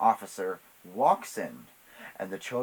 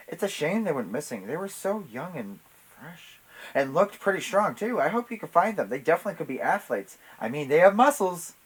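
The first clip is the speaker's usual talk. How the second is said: lightly acted shopkeeper, pitched higher; higher, mock-sympathetic chatter; feigned concern; 8 semitones higher, 6 dB louder than usual. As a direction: act a bit too innocent. Martha feigns concern to officer.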